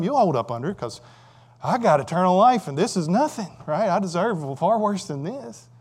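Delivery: usual speaking voice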